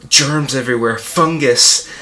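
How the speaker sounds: loud, revolted exclamation; disgusted outburst. Acted. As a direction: spit it out loudly in horror. Disgusted rant about his squalor.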